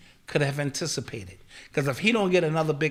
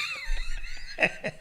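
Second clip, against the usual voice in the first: shrieking laugh; 6 dB quieter than usual. Real, not acted. Laughing at own joke.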